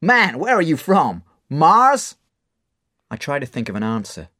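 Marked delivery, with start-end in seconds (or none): 0.0-2.2 loud, higher teasing, peaking on Mars; teasing bar owner. Acted. Mark teasing Dave: loud mock disbelief. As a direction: tease the boy. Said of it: "Mark laughs".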